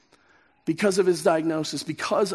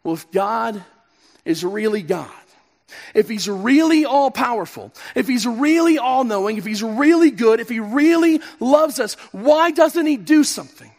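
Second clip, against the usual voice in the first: anguished questioning; 4 semitones higher, 6 dB louder than usual. Pretend. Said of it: "We begin to ask the question".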